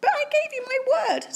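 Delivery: a small bright-eyed mouse piping up; tiny mouse voice. Acted. Polite mouse asks Bear for cheese, squeaky high.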